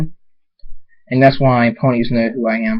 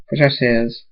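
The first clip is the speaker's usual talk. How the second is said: ordinary delivery, nothing marked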